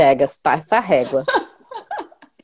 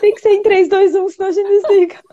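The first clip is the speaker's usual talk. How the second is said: high, giggling, playful; giggly late night call; 9 semitones higher than usual. Pretend. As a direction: laugh through a sweet countdown. Couple-style goodbye game.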